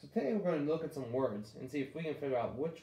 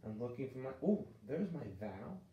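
ordinary talk, nothing marked

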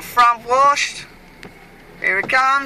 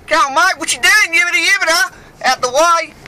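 road rage yell; 7 semitones higher, 4 dB louder than usual. Acted. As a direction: bellow at the car ahead, hammed up. Yelling at driver in front.

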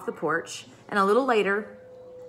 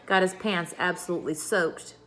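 ordinary delivery, nothing marked

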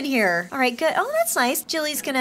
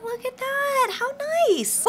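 high, cooing approval; delighted, pitched-up admiration; admiring coo; 11 semitones higher, slower than usual. Real, not acted. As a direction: coo sweetly over something cute. Admiring the snowman.

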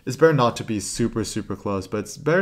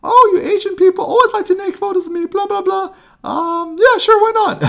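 much higher, louder squeaky put-on woman's voice; imitating the woman; 10 dB louder than usual. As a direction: ham up her dismissive reply in a high shrill pitch. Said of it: "And she goes".

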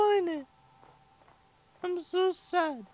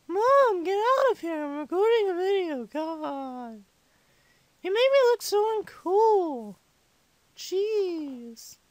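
put-on five-year-old complaining to mom, tailing off; exasperated child; slower than usual. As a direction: shoo mom away like an embarrassed kid. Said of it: "I talk like a five-year-old".

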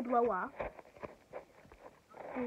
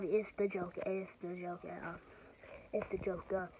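ordinary talk

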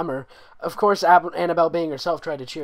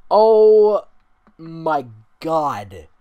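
loud gasped oh; 3 semitones higher, 12 dB louder, much slower than usual. Real, not acted. Reader recoiling at terrible fanfic, loud shocked oh. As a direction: blurt it out in shock.

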